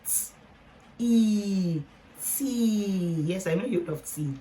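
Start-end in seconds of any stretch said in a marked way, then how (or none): 0.1-3.2 "t", long "e", then stretched "tea"; blending sounds. Shown as a demonstration. Teacher blending a long-e word for learners, sounded out. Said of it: "let's blend them together".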